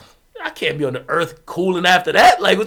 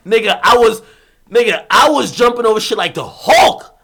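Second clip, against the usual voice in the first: louder excited shout; 4 semitones higher, 8 dB louder than usual. Real, not acted. Telling how he chased the roommate, loud and excited.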